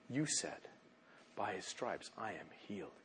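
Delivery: low, very soft recital of a promise; hushed prayer. Acted. Sick believer quotes God's promise back to Him, hushed prayer.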